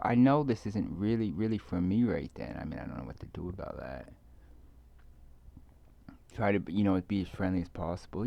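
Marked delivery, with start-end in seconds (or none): none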